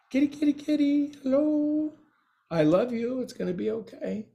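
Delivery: put-on high baby talk to a cat; sweet, high kitty voice; calling a cat. Talking to the cat.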